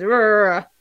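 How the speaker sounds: name mumbled into an unintelligible "Rrrr"; "Rrrr" as a slurred, wordless mumble in place of a name. Shown as a demonstration. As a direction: blur the name into "Rrrr" so nobody can catch it. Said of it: "because I mumbled it".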